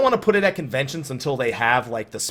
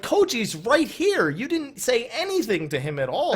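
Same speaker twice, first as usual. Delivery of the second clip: higher-pitched mock reproach, dragging out the opening; incredulous scolding; 10 semitones higher than usual. Calling out a friend for ignoring Koji, mock-indignant. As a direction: retell it as a half-laughing protest.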